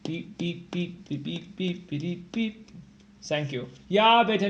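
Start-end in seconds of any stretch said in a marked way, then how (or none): 0.0-2.7 whispered beeps. Pretend. Imitating machine beeps.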